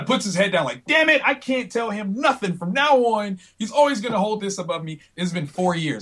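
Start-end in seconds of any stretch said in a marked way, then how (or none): 0.8-5.0 high-pitched mock exasperation; retold defeated griping, pitched up; exasperated lament. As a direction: grumble like you'll never live it down. Artist's exasperated reaction, retold.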